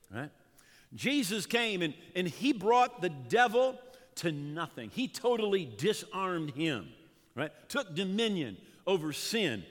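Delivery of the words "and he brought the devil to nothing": ordinary talk, nothing marked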